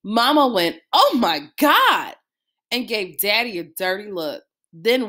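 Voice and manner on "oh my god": dismayed. Acted. Mama dismayed by the record player.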